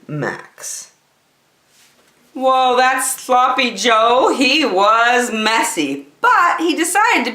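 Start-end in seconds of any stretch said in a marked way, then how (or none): none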